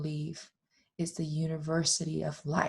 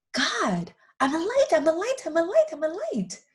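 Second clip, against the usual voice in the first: put-on high, light childlike soul calling out; high little soul voice; 13 semitones higher, 4 dB louder than usual. Little soul calls out eagerly to God, high childlike voice. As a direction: sing out your glow like an eager child.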